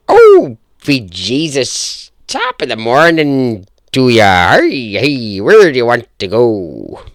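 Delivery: loud, slow stage-Irish brogue, mock cheery; hammed-up Irish accent. Podcaster mocking an Irish taxi driver, loud hammed-up Irish accent. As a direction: ham up a cartoon Irish cabbie greeting a fare. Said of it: "my Irish accent".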